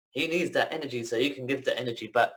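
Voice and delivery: ordinary talk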